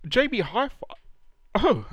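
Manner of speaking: hiccuping mid-sentence; hiccups. Real, not acted. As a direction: talk through hiccups. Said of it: "I'm hiccuping a lot".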